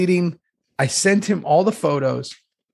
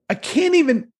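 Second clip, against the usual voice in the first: flustered retold outburst; 10 semitones higher than usual. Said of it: "I go".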